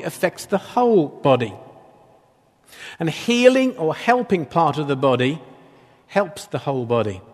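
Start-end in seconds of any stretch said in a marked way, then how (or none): none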